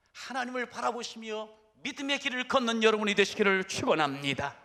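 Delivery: usual speaking voice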